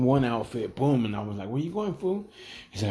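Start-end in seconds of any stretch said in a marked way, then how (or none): 1.5-2.3 higher, softer incredulous question; retold questioning. Bouncer asks his partner where he's off to, questioning. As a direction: toss out a puzzled, high-pitched question.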